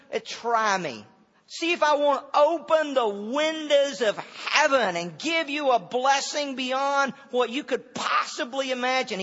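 slow, loud, daring voice of God; a touch of divine swagger; God's challenge. Retold or imitated. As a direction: let God answer with a bold dare.

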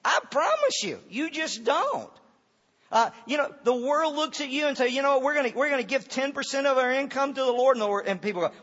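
loud, high preacher's cry; full-throated sermon climax; fiery preaching. Acted. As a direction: preach it out full force to the crowd.

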